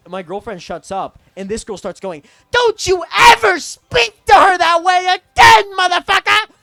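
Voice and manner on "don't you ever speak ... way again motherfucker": screeching, much higher and much louder, slowed rage; furious shout. Retold or imitated. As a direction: scream it like an outraged protector. Cousin screaming from the back seat.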